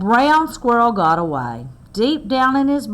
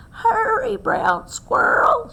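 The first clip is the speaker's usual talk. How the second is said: high urgent grandmother's call; slower than usual. Acted. Grandmother at the door calling Squirrel: high urgent call. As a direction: call him home urgently. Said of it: "she called".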